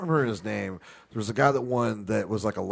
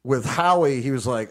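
ordinary talk, nothing marked

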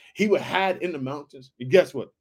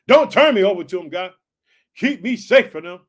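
louder, urgent begging; loud plea; 8 dB louder than usual. Acted. David begging God to keep him safe, loud. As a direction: plead not to be handed over.

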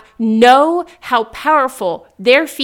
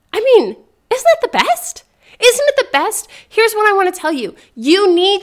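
much higher, thrilled and gushing; excited gush; 8 semitones higher than usual. Real, not acted. Podcast host gushing to listeners about hard things, excited. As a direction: squeal it like the best news ever.

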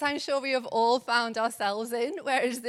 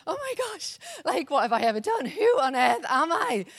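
high, flustered mock panic building to a laughing cry; playful pretend panic, words tumbling out fast; mock internal panic; 5 semitones higher, 6 dB louder than usual. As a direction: act out a comic rush of panic, getting louder. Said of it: "a small internal panic".

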